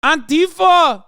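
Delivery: high, loud chant of Antifado; mock protester shout. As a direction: yell it like an angry Brazilian rally crowd. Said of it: "They're saying".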